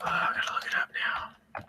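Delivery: whispered aside to herself; whispering while searching for the name; whisper to self. Real, not acted. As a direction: drop to a breathy whisper, thinking aloud. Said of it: "muttering to herself".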